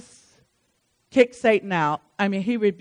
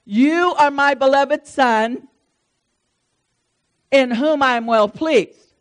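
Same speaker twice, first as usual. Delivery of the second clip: mildly raised, slow proclaiming voice; loud proclamation; 3 semitones higher, 5 dB louder, slower than usual. Acted. God the Father speaking over his Son, loud proclamation. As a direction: proclaim it deliberately as from heaven. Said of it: "that voice said really loud".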